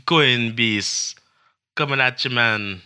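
put-on playful Caribbean accent, calling it out. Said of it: "there goes all our Caribbean followers".

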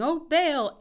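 high, loud cry to a silent god; shouting. A narrator's reading. Prophets of Baal calling on their god, loud shout. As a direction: call out to Baal, pleading.

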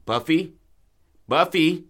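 high, loud questioning call; panicked calling. Acted. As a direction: call out for a missing dog, alarmed. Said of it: "get panicked".

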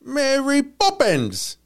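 high, slow, drawn-out mock Cockney. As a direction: sing out each word in a silly high Cockney.

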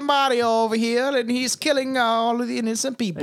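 hammed-up Italian accent, laughing; bad Italian accent. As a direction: silly fake Italian accent. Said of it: "a really bad Italian accent".

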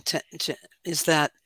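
ordinary talk, nothing marked

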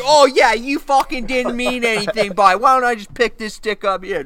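mock threat. Threatening cow.

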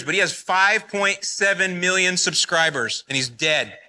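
ordinary delivery

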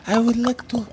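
high, soft attempt at an African accent; bad African accent. Podcaster asked to read a message, bad African accent. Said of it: "Read it in African accent, please".

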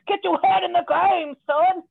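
loud, high shouted coach pep talk; hammed-up sideline coach shouting at a player; mock coach yell. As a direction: bark it like a football coach. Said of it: "like, coach and like".

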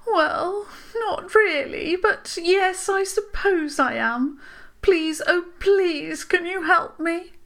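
high, slow pleading voice with a sigh; a touch of sighing sadness; sighing plea. A narrator's reading. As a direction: beg for help wearily. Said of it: "The voice sighed".

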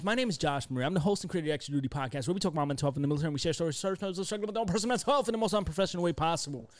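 rushed sign-off patter, racing; speeding up. Acted. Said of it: "I gotta learn to say it faster and faster".

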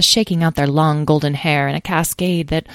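plain reading voice

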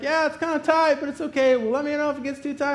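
high-pitched polite reply; high, agreeable. Retold or imitated. Squeezed patient reassuring the operator, high and polite. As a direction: play down discomfort in a high voice.

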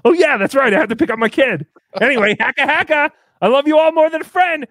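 high, loud and bubbly; excited sign-off. Real, not acted. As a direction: sign off with a big grin.

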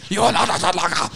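fast, hammed-up angry line; mock angry quote. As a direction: spit it out in mock anger. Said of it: "He's so angry".

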